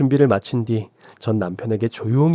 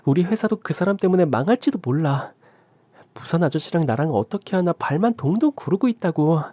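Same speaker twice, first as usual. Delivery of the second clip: higher, insistent daughter; urgent pleading; 8 semitones higher than usual. Acted. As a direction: press the father to act now.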